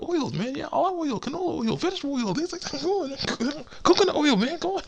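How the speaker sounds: very high falsetto list of temptations; coaxing walrus cook. Retold or imitated. Walrus tempting Crash with bath oils, coaxing falsetto. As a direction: coax in a squeaky comic villain voice. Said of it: "doing the same thing with this walrus".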